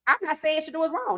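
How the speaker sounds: normal talking voice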